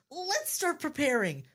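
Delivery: high, eager little voice; beaming. Acted. Blueberry rallies everyone for the party, beaming. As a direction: chirp it with eager cheer. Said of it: "he beams".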